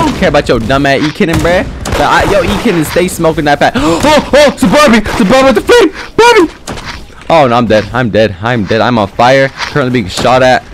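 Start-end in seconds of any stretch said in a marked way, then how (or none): 3.8-7.2 much higher, rising alarm at the enemy; excited alarm. Real, not acted. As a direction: yelp it out as the threat appears. Spots a flamethrower Barbie.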